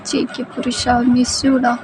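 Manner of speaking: high, cooing lover; soft-hearted pet-name talk; affectionate girlfriend. Acted. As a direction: say it sweetly in a small high voice. Girlfriend sweet-talks her boyfriend.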